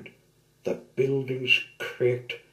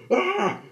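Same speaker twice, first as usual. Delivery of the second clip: high yelp of fright; startled cry; 7 dB louder than usual. Acted. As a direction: cry out in sudden fear. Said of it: "called Big Mick".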